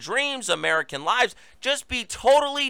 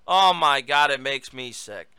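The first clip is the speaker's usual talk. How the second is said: ordinary delivery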